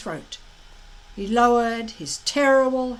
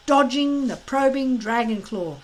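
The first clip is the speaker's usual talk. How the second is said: ordinary delivery, nothing marked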